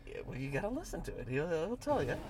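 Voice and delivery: ordinary delivery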